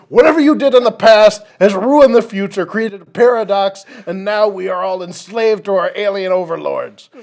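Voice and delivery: high, loud doomsday scolding of Gary; dramatic scolding. Pretend. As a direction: blame Gary for ruining the future, high and loud. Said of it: "and he's like".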